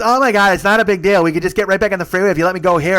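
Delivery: high, loud, hurried pleading; imitated loud protest. Passenger arguing to be let out, loud protest.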